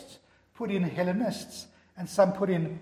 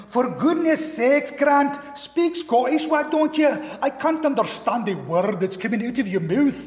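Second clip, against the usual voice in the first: annoyed Scottish sister; 5 semitones higher, 5 dB louder than usual. Retold or imitated. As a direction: scold him like an exasperated Scottish older sister. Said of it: "who was very Scottish".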